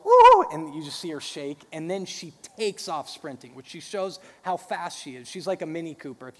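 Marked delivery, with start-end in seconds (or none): 0.0-0.5 high excited squeal. Retold or imitated. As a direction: squeal like a thrilled toddler. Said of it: "And she'll go".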